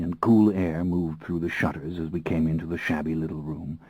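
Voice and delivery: usual speaking voice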